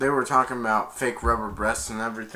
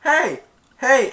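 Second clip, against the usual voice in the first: much higher, louder hey; mock warning shout; 12 semitones higher, 9 dB louder than usual. Guy yelling at Dizzy grabbing the mop, higher and louder. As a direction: shout to stop him. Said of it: "the guy's like".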